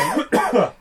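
sudden hacking cough; coughing fit. Real, not acted. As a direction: choke and cough hard. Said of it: "[name] is coughing".

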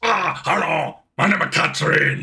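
hammed-up impression of a co-host at work; mock work-call impression. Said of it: "I'll try it a little bit".

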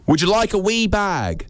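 high Scottish lilt on "wee bag"; Scottish shop assistant. Retold or imitated. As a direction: ask it like a Scottish bookseller. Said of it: "the person behind the counter said".

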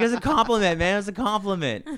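high, loud backpedalling protest; acted pleading protest. Acted protest after friend's rebuke.

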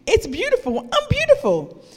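rising high in wonder; amazed delight. Acted. Teacup sees its reflection, high and amazed. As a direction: squeal with joyful amazement.

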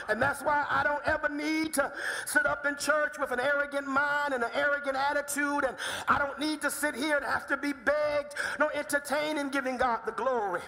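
swept-up sermon peak; full-force preaching. Acted. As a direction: preach the climax with a catch in the throat.

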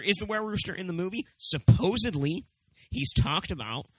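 ordinary delivery

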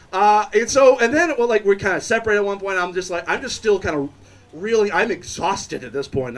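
usual speaking voice

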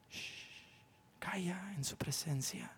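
whispered hush, no voice. Shown as a demonstration. Pastor shows the congregation how to be still, whispering. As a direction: hush them into silence. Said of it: "entrénate a callar en su presencia".

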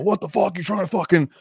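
high, aggressive mock yell; aggressive loud Luke. Luke telling off the cop who wants a bribe, high aggressive yell. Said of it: "Luke's voice is so aggressive and loud".

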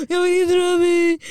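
loud, high imitated whine; mock crying child. Small child refusing mum's kisses, mock crying. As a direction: wail it like a protesting child. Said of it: "jag började gråta".

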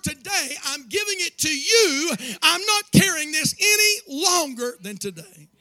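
high, loud, slowed shouting plea; impassioned altar call. Acted. Altar call at full force.

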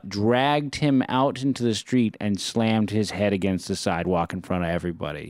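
normal talking voice

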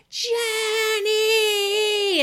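high wailing cry of the name, close to tears; desperate scream. Retold or imitated. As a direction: cry the name out as if all hope is gone. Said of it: "hearing my mother screaming and screaming".

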